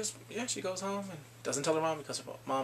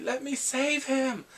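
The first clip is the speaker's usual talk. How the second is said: high, drawn-out dramatic cry; mock desperate plea; 9 semitones higher, slower than usual. Bongsu in the final moment, hammed-up desperate plea. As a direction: stretch it into a pleading wail.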